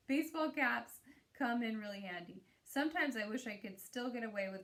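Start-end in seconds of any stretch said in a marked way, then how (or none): 0.0-2.4 laughing. Real, not acted.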